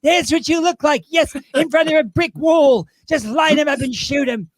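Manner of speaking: high, brash insult-comic voice; Joan Rivers. Acted. Drag performer roasts the interviewer as the comedienne, high brash voice. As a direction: needle the guest in a high comedienne voice. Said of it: "she talked like this".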